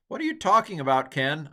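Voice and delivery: fast, loud, high exasperated question; exasperated listener. Pretend. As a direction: whine it like a fed-up student. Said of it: "I'm sorry".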